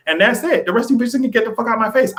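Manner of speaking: telling unwanted mourners to leave, fast and sassy; sassy dismissal. Pretend. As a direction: wave them all out of the room.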